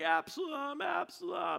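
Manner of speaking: crying out the name. Retold or imitated. King covering his face, crying out for his son, anguished high cry. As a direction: call his son's name through tears. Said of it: "he kept on crying".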